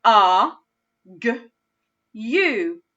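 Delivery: A, g, u said as separate sounds; sounding out. Shown as a demonstration. Teacher sounding out argue again. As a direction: segment A, g, u one at a time. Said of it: "Let's do that one more time together".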